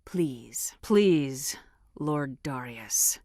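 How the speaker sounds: drawn-out mock-polite Lord Darius; mock pleading. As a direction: fake begging dripping with contempt. Sarcastic begging to Darius.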